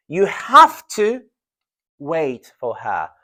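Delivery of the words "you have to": emphatic have to; stress on have to. Shown as a demonstration. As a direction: have to said high and loud, slowly. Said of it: "teacher contrasts modal verbs".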